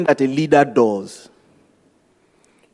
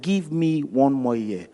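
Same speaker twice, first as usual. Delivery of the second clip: quieter and slower, gently pleading; soft slow plea; 6 dB quieter than usual. Retold or imitated. Pastor repeating the farmer's plea to the owner, soft and slow. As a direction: let the words fall soft and drawn out.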